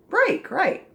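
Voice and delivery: "Break" with long A, high and bright; correct reading. Shown as a demonstration. Teacher confirms the right reading "Break". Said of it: "Is it breek or breck or break".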